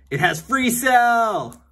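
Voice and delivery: high, loud, slow exclamation; mock delight. Real, not acted. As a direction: gasp it out like a thrilling find. Delighted discovery of game.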